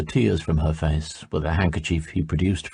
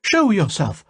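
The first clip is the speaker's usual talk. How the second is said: high, loud command; commanding sorceress; 13 semitones higher, 3 dB louder than usual. A narrator's reading. Salmataya ordering the stranger to appear, high and loud. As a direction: order him out.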